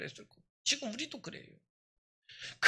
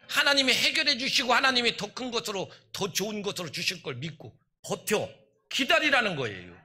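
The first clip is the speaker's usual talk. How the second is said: preaching at full force; 2 semitones higher, 6 dB louder than usual. Real, not acted. Pastor urging believers to hold on, loud climax. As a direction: lift the church with it.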